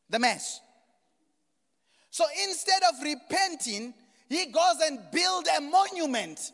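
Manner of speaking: high, very loud pulpit shout; full-force preaching. Real, not acted. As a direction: drive each phrase home loudly, with pauses between.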